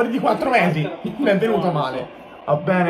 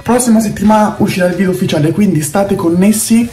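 ad read; 8 dB louder than usual. Acted. Fake band ad at half-time.

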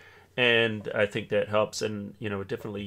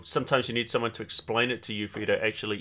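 ordinary talk

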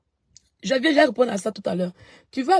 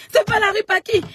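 very high, louder mock cry of the rival; mocking her cry; 9 semitones higher, 5 dB louder than usual. Streamer mimicking the woman's cry again, very high mock cry. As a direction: squeal her words back at her mockingly. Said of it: "Elle crie".

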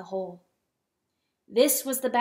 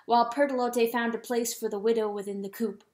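ordinary delivery, nothing marked

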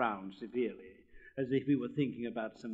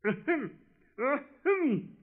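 loud, much higher, indignant throat-clearing; angry ahem; 8 dB louder, much slower than usual. Acted. Rocket trying to get a word in, angry ahem. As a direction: cut in with an annoyed ahem. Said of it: "said the rocket angrily".

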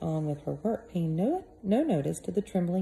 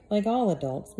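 ordinary delivery

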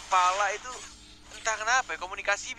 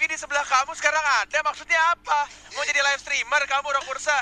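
high, loud playful yelling; mock yelling; 8 semitones higher, 5 dB louder than usual. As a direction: yell at him in jest. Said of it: "saya jadi teriak lagi deh".

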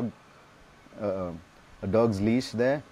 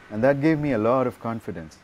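ordinary talk, nothing marked

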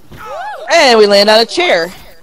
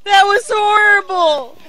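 horrified wail; 8 semitones higher than usual. Real, not acted. Player reacts to the ripped toy, horrified. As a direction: wail it high, appalled at the sight. Reacting, gets misheard as adorable.

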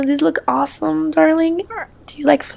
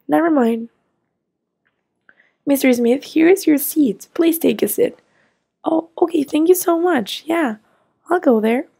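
usual speaking voice

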